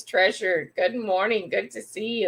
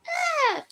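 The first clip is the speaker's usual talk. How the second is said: startled yelp. Real, not acted. Startled while grabbing water.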